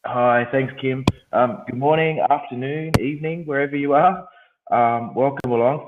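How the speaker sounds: croaky voice from a head cold. Real, not acted. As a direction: talk through a croaky, cold-sore throat. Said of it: "apologise for the croaky voice".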